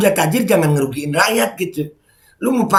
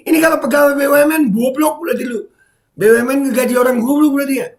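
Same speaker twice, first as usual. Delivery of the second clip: high, heated insult; mocking scorn at the commenter; angry rant; 11 semitones higher, faster than usual. Real, not acted.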